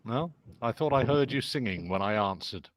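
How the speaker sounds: normal talking voice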